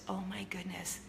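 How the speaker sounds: soft unvoiced whisper. Friend whispering the gossip to the girls. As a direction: hush it so nobody overhears. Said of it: "She says".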